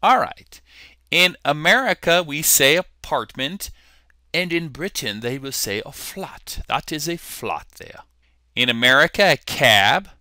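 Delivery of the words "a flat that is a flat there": soft amateur British accent; lightly attempted British English, quieter. Shown as a demonstration. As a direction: put on a mild British accent. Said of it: "give you my British accent".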